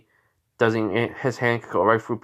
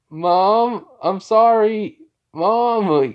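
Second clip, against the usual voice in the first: high, raised-voice apology; pleading call; 10 semitones higher, 5 dB louder, much slower than usual. Retold or imitated. Son calls to mom through her door, pleading.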